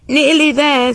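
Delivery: high, loud call; calling through gloom. A narrator's reading. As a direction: call out reassurance from the dark. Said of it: "the enchanter's voice through the gloom".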